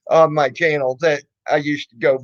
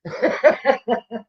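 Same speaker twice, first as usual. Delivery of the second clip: amused laugh; 5 semitones higher than usual. Real, not acted. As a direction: laugh off the troll. Laughing at the troll.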